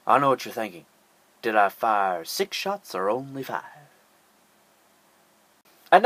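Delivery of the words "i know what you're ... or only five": low, quiet tough-cop growl, lightly done; a hint of a steely cop staring down a punk; Dirty Harry. Vlogger mocks the cop, Dirty Harry style, low and quiet. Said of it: "done a Dirty Harry impersonation".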